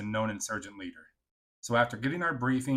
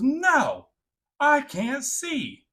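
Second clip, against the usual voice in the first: high, slow whining voice, much louder; whiny refusal; 8 dB louder, much slower than usual. Retold or imitated. Soldier refusing her squadmate's order, high slow whine. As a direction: drag the words out like a sulky kid.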